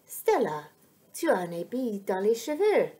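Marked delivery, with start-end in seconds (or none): none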